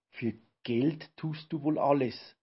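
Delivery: ordinary delivery, nothing marked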